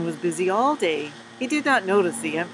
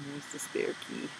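whisper; 3 semitones lower, 12 dB quieter than usual. Real, not acted. Pointing out picture to listeners.

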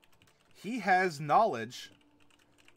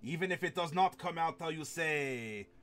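put-on French accent. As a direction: speak as a hammed-up Frenchman, taking time.

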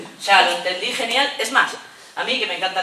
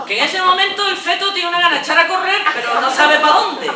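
laughing, much higher and louder; 10 semitones higher, 8 dB louder than usual. Real, not acted. Teacher laughing about a fetus wanting to flee. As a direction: laugh as you picture it.

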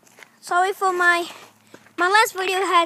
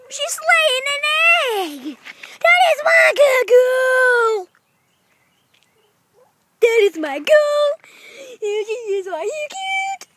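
gushing over pet; 5 semitones higher than usual. Real, not acted. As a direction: gush proudly in stretched-out pet talk. Gushing praise of laying hen.

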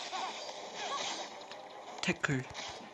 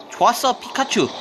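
Pokemon trainer call; 8 semitones higher than usual. Pretend. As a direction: command it like a trainer. (포켓몬 트레이너처럼 외치기)

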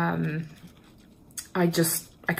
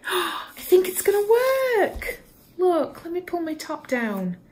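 gasp, then high, thrilled realisation; high-pitched, breath-caught excitement; excited hope; 12 semitones higher than usual. Real, not acted. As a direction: squeal it with sudden hope. Gasps as propped camera holds.

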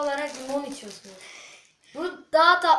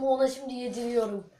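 ordinary delivery

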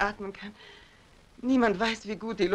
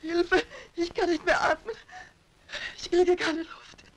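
high, sobbing cry for help; panicked crying; 7 semitones higher than usual. Acted. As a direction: gasp it out, running out of air. Trapped woman suffocating.

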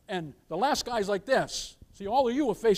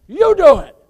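much louder and higher, a sudden charge; preacher's shout; 8 semitones higher, 15 dB louder than usual. Real, not acted. Pastor charges the church to act themselves, shouting. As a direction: hurl it at the congregation like a command.

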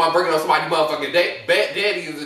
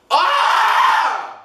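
short loud exasperated cry, pitched up; frustrated yell; 9 semitones higher, 5 dB louder than usual. Real, not acted. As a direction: let out a quick annoyed scream. Frustrated about her hair.

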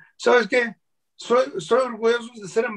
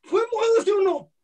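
much higher, insistent mock grilling; retold insistent questioning; 12 semitones higher than usual. Demanding a yes or no from the slur user, high mock grilling. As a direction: pin him down.

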